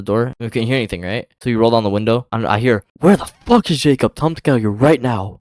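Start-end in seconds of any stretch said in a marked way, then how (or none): 3.0-5.4 angry mother. Retold or imitated. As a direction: play the furious mother demanding her kid. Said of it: "She looked very angry".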